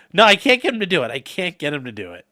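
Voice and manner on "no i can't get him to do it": ordinary talk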